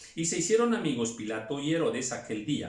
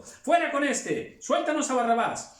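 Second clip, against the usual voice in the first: much higher, louder slow yell; crowd shouting; 11 semitones higher, 5 dB louder than usual. Acted. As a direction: roar it as the mob. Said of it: "dio a voces a una diciendo". (la multitud grita a Pilato pidiendo a Barrabás)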